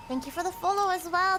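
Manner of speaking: put-on a playful touch of English accent. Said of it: "you said follow with an English accent".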